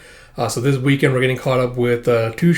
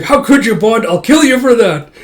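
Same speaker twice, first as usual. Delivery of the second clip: high, loud mock rage; imagined furious Yor; 9 semitones higher, 7 dB louder than usual. Yor imagined scolding Bond, high loud mock fury. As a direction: threaten him in a hammed-up fury. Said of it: "being like".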